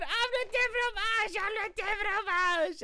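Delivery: very high, loud frantic yell. Retold or imitated. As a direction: scream it in panic. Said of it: "he starts yelling".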